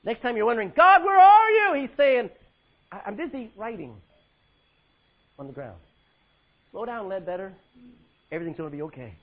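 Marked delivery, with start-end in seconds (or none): 0.8-1.7 high, loud pleading call to God; desperate cry. Acted. A believer crying out to God, loud and pleading. 2.9-9.1 gentle reassurance. Acted. God calming an anxious believer, soft and reassuring.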